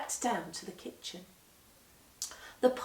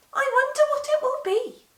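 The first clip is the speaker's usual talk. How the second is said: high, quick, curious lady's voice; wondering old lady; 7 dB louder, faster than usual. A narrator's reading. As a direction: wonder aloud brightly. Missus Molly wonders aloud.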